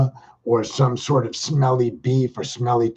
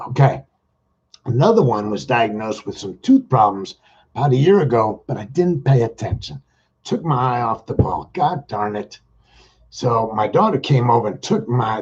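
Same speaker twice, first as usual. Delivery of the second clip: ordinary talk, nothing marked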